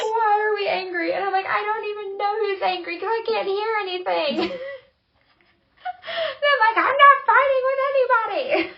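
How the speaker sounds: high, loud, half-crying confusion; baffled. Retold or imitated. Deafened friend protesting innocence, high and loud. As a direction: plead your innocence, high and bewildered. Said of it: "It's like".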